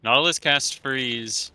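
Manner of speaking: usual speaking voice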